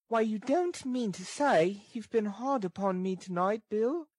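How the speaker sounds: put-on high-pitched girl's character voice; high voice, rising on the name; pleading girl. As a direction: plead in a high, light register. Narrator voices Nancy pleading.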